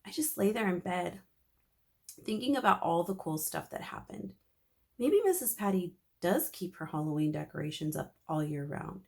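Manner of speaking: plain reading voice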